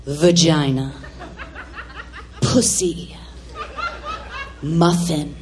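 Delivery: very low, drawn-apart taboo words; mock daughter's monologue. Scandalised mother quoting daughter's stage words, very low mock recital. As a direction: intone each word deep, leaving pauses. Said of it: "going on and on about her".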